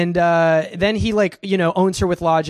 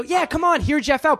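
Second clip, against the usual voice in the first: mock persuaded viewer; 9 semitones higher than usual. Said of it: "viewers are supposed to go".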